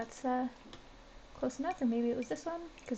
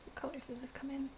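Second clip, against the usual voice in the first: whispering; 8 dB quieter than usual. Real, not acted. Muttering to herself while browsing.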